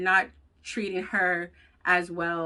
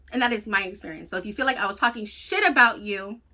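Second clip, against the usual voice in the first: ordinary delivery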